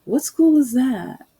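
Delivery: imitating a doubting cop; suspicious question. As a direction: grill her with mock disbelief. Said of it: "He was like".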